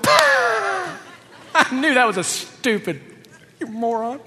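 high, loud, laughing mock ridicule; mock jeering. Imaginary classmate ridiculing the student, mock jeering. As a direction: burst out jeering, hammed-up.

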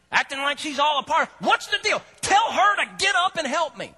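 high, loud exasperated complaining; hammed-up angry sister griping; mock frustrated rant. Martha griping to Jesus about her sister, loud and high. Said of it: "She says".

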